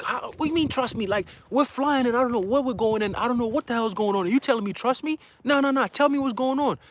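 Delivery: high-pitched frantic mother's voice; panicked mom. Retold or imitated. Mom questioning her son mid-flight in her dream, high and frantic. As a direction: demand answers, high and urgent.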